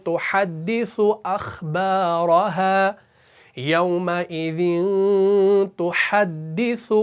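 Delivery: تُحَدِّثُ with a doubled dal, then a tha; slow recitation. Shown as a demonstration. Teacher reciting surah Zalzalah slowly, careful. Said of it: "پہلے دال آ گیا".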